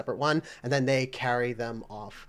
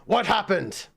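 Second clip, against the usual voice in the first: higher, urgent exclamation; alarmed call; 6 semitones higher than usual. Acted. Guard rushing over to the blast, alarmed.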